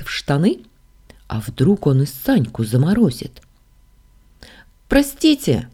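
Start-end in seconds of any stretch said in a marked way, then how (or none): 4.9-5.7 high, polite call for attention; tentative, high-voiced hail. A narrator's reading. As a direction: hail him apologetically in a raised pitch. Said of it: "окликнула я Лорана".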